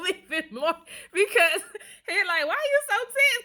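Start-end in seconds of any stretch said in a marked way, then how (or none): 2.5-3.4 laughing. Real, not acted. Quoting masseur amid laughter.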